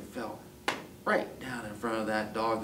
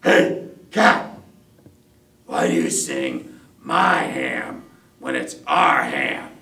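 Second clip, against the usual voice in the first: loud, punched-out frustrated demand; frustrated dog; 13 dB louder, slower than usual. Acted. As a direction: confront the cat loudly as an exasperated dog. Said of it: "He was real frustrated".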